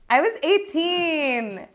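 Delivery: high, slow, stretched-out excuse; long high whine of self-defence; drawn-out plea. Real, not acted. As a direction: plead your case in a high drawl. Mock-defending herself to laughing audience.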